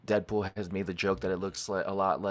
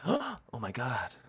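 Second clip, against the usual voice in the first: retold gasp of awe; 3 semitones higher, 5 dB quieter, slower than usual. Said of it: "you can hear Deadpool go".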